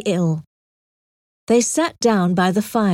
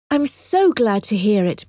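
ordinary delivery

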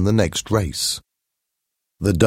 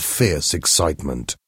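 usual speaking voice

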